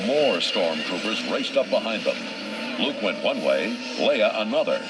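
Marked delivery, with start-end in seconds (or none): none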